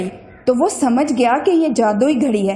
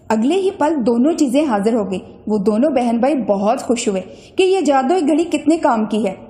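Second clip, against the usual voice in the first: plain reading voice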